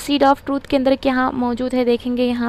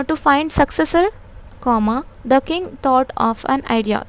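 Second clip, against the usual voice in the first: slow dictation; much slower than usual. Shown as a demonstration. Dictating example slowly.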